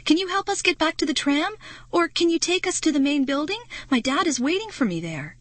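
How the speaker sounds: high, fast pleading; begging. Acted. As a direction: beg to be taken back to Dad. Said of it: "I begged".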